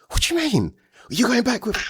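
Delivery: high squeaky disbelief; a sudden high-pitched what of disbelief; shocked squeaky what. Retold or imitated. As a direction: squeak it out like you can't believe it. Friend's shocked reaction retold.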